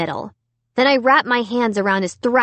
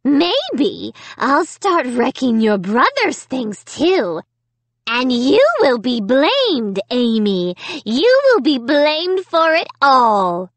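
slow, high threatening dummy voice; menacing dummy; 5 semitones higher, 3 dB louder, slower than usual. A narrator's reading. Evil dummy threatening to get Amy blamed, slow menace. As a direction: draw out each threat, swelling.

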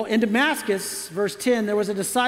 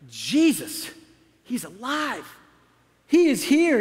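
stunned realization; 2 semitones higher, slower than usual. Acted. Saul realizing, acted.

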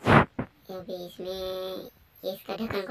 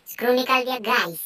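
excited; 5 semitones higher, 10 dB louder than usual. Real, not acted. Excited reveal to viewers.

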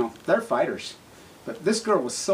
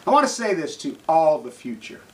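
ordinary delivery

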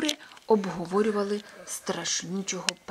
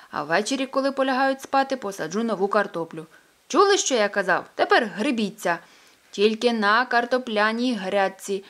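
louder, firm farmer telling hens off; old Pettson scolding hens; 2 semitones higher, 8 dB louder than usual. Acted. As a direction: lay down the rules to the hens.

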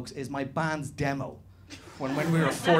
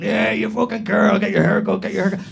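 jeering; 4 semitones higher, 9 dB louder than usual. Retold or imitated. Rugby lads taunting him on the ground, loud jeers. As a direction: bellow the taunts while circling. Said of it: "other guys running around going".

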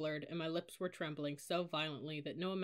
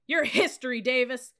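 loud, high bellow; furious drill-sergeant shout; booming threat; 7 semitones higher, 13 dB louder than usual. A narrator's reading. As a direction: fill a stairwell with your voice. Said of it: "his voice filling the stairwell".

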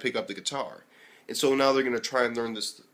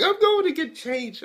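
put-on much higher, sweet girlish voice; girl's voice; 6 dB louder than usual. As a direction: mimic her in a high girlish voice. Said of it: "And she's like".

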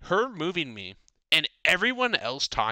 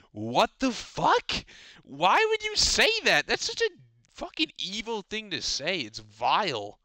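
high, drawn-out indignant outburst; outraged; 8 semitones higher than usual. Retold or imitated. Telling off a classmate for a cruel remark, outraged. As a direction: start incredulous and high, come down to a slow disgusted finish.

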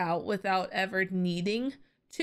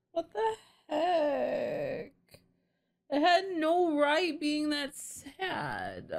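teary disbelief; 4 semitones higher, slower than usual. Real, not acted. Tearing up at a sad anime scene.

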